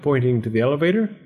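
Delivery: ordinary delivery, nothing marked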